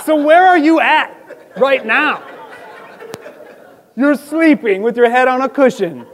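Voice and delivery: chuckling as he teases; laughing. Real, not acted. Laughing while accusing Jesus.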